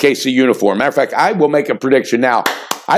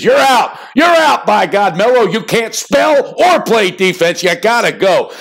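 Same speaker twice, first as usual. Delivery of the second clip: mock dismissal; 6 semitones higher than usual. Teammates voiced telling Melo to leave, yelled high. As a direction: shout him out the door.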